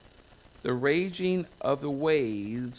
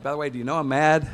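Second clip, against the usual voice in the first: fast and much louder, playfully angry; mock angry rant; 2 semitones higher, 9 dB louder, much faster than usual. Preacher joking about yelling at God, fast and loud. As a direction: rattle it off in a hammed-up fury.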